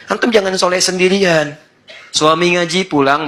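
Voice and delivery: ordinary talk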